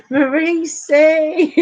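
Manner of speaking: high, slow, drawn-out call through giggles; gleeful name call. Real, not acted. Streamer calling out a friend who joined, high and drawn out. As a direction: stretch the name out as she laughs.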